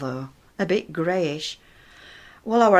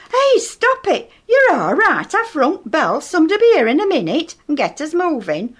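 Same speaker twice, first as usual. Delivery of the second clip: high, loud, firm order to calm him; urgent call; 8 semitones higher, 4 dB louder than usual. Retold or imitated. Edith shouting at panicking Eric in the stuck lift, urgent and loud. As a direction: snap him out of his panic.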